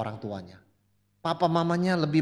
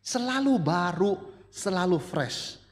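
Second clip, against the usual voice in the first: slow recalled words; slower than usual. Retold or imitated. Retelling the daughter's words.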